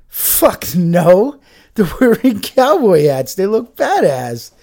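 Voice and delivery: loud and high, playfully indignant; mock outraged shout. Real, not acted. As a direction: bark it out like it's obvious. Mock answering the question loudly.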